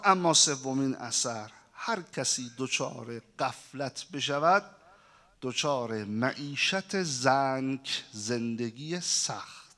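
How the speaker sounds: usual speaking voice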